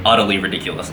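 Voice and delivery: ordinary delivery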